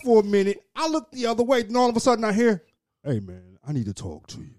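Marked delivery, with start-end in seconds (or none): 3.0-4.5 very low, quiet impersonation; deep voice. Retold or imitated. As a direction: drop way down low and soft, imitating him. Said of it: "how high his voice is normally".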